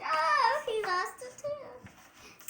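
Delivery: surprised. Real, not acted. Child exclaims at picture.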